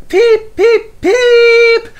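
very high loud engine peep; whistle imitation. A narrator's reading. Toby whistling in alarm, high piercing peeps. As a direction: shriek it like a train whistle. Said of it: "whistled Toby".

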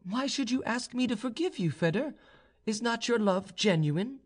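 high-pitched, questioning heroine; young noblewoman. A narrator's reading. Proud young woman questions her suitor's apology, high-pitched. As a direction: voice her high, gently challenging him.

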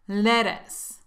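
ordinary delivery

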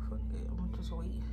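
fast hushed whisper to herself. Retold or imitated. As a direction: whisper it quickly like a private thought. Retelling her own inner thought.

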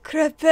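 crepe said in an exaggerated other way; loud, exaggerated crepe; mock alternative pronunciation. Shown as a demonstration. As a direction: overdo crepe as a doubtful alternative. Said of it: "Am I supposed to say".

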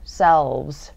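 "selves" with a distinct voiced V; clear V sound. Shown as a demonstration. Teacher modelling selves for learners, clear V. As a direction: sound the V before the final Z in "selves". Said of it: "V 발음".

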